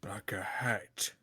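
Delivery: slow, soft unvoiced whisper. Real, not acted. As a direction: whisper it as a sly aside. Deadpan aside to the table.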